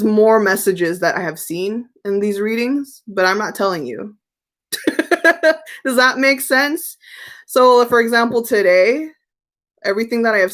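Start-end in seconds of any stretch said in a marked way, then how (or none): 4.7-5.8 high giggle; teasing giggle. Real, not acted. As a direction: giggle at keeping a secret. Laughs after refusing to tell viewers.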